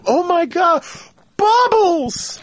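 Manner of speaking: mock high Michael call; high Michael cry. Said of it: "I just remember Michael going".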